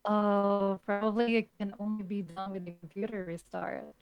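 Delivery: usual speaking voice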